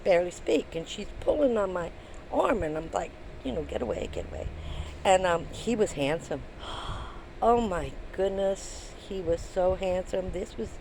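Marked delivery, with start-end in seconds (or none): 3.6-4.5 whispered, hurried get-away hiss; urgent whisper. Retold or imitated. Whispering to her friend to leave the star alone. 6.5-7.4 breathy admiring gasp. Retold or imitated. Remembering how handsome he was.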